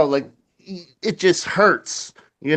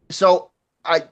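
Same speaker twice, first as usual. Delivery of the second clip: ordinary talk, nothing marked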